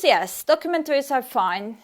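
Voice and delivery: ordinary talk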